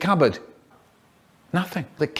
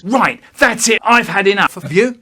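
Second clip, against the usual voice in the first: loud, raised, fed-up yell; dad yelling; 4 semitones higher, 6 dB louder than usual. Retold or imitated. Dad losing his temper at his child, yelling loud. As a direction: bellow it like a father at the end of his rope. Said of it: "He yells".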